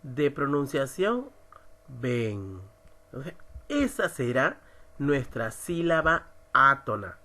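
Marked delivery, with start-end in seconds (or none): 1.9-2.6 'Ven' low and unaccented; weak unstressed syllable. Shown as a demonstration. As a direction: let 'Ven' drop in pitch, without force. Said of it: "cuál tiene menor intensidad de pronunciación". ('Ven' como sílaba átona, en tono bajo)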